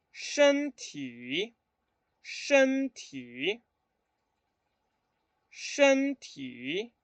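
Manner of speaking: "shen ti" three times, tone mark on the I; 示范身体的声调; Mandarin tones. Shown as a demonstration. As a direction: model "shen ti" carefully. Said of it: "Si colocaron así".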